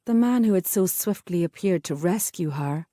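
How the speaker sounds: ordinary delivery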